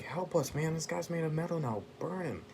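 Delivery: high-pitched mock plea; pleading for help. Colossus begging a teammate to burn the metal foe, high mock plea. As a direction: voice jumps up, urging a teammate on.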